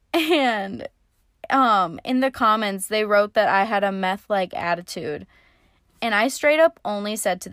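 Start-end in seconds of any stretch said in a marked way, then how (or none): none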